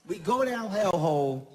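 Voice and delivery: normal talking voice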